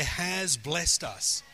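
"Has" stretched out very slowly for repeating; very slow, deliberate emphasis on "Has"; stress on Has. Shown as a demonstration. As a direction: lead the congregation with a drawn-out "Has". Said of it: "Everyone say".

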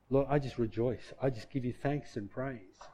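soft, hushed thanksgiving, fading away; quiet praise prayer. Shown as a demonstration. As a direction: murmur praise gently to God. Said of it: "I began to act on that".

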